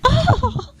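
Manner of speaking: high, scandalized gasp; mock shock. Child hearing brother swear, high mock-shocked gasp.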